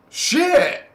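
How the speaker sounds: very high, loud exclamation; shouted curse. Real, not acted. Shocked by talk of divine wrath, shouted. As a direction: blurt it out in shock.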